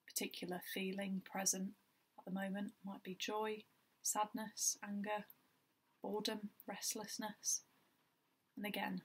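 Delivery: even, unhurried meditation guide with long pauses; guided meditation. Acted. Guiding listeners through a body scan, calm meditation voice. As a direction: lead gently in short phrases, leaving space between them.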